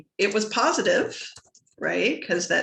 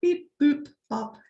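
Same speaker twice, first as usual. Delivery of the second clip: robot beeps; 8 semitones higher, slower than usual. Acted. Computer noises while it loads.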